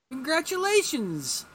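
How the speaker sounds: high, drawn-out mock congratulation; Zan. Zan congratulating Hawkman on his grim find, gushing. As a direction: stretch it out loud and high. Zan's inappropriate congratulations, retold.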